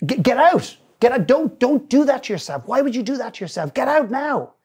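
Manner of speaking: high, loud blurted plea; alarmed shout of get out; urgent outburst. Retold or imitated. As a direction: blurt it out before he finishes. Man blurts urgent plea to son.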